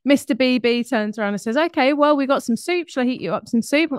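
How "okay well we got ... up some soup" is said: lightly voiced husband, brighter and eager; helpful husband. Husband offering his hungry wife soup, lightly voiced and eager. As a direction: offer soup like a patient helper.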